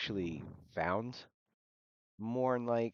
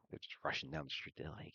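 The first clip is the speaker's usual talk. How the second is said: normal talking voice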